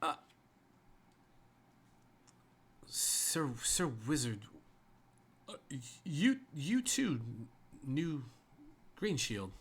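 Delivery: stammering, flustered guardsman; halting, tripping over each word; stuttering. Pretend. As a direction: repeat words and leave gaps between them. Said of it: "if you'd stop stuttering".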